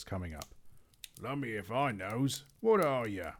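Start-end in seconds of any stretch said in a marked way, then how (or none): 1.2-3.3 louder, puzzled cockney troll; a bewildered troll barking at the hobbit; gruff troll. Acted. Trolls demand what the hobbit is, loud puzzled troll. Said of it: "said the others".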